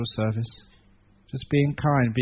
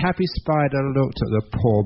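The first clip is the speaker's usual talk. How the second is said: ordinary delivery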